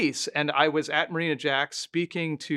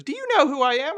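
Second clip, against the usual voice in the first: put-on pitched higher and louder, an imperious old lady; mock grand-dame challenge, higher voice; imitating an older woman; 11 semitones higher, 5 dB louder, faster than usual. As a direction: play the haughty widow demanding recognition. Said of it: "And she goes".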